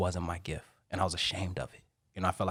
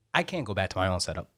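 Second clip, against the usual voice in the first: ordinary delivery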